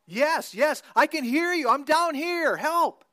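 high, loud eager shouts upward; desperate shouting. A narrator's reading. Jack shouting back up to the voice, desperate. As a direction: call up, desperate to be heard.

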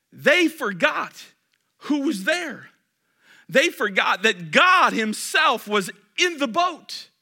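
loud, high and slowed; emphatic preaching. Real, not acted. Pastor stressing the disciples forgot God, loud and slow. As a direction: lean hard on every word.

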